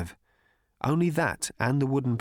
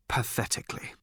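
voiceless, softer whisper of "pathetically."; 5 dB quieter than usual. Acted. Slartibartfast lamenting failure, whispered "pathetically.". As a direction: breathe "pathetically." out with no voice.

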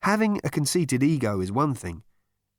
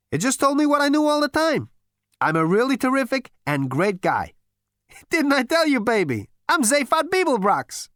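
much higher, louder, self-satisfied brag; smug Zaphod; 14 semitones higher, 5 dB louder than usual. A narrator's reading. Zaphod bragging to Gargravar, loud smug boast. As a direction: boast like the greatest guy alive. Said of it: "Zaphod shrugged smugly".

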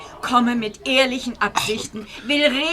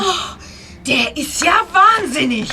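outraged; 6 semitones higher, 3 dB louder than usual. Acted. Frau empört nach Angriff.

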